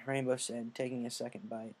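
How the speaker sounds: ordinary delivery, nothing marked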